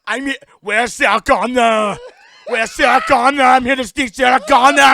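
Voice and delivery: high, loud robotic shout; Terminator voice. Pretend. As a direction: bark it as the Terminator. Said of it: "I am the Terminator".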